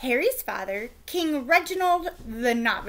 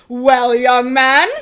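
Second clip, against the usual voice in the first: slow, much louder stern king; demanding king; 14 dB louder, slower than usual. A narrator's reading. King confronting naughty prince, loud and slow. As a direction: boom it down at the boy. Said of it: "the king demanded".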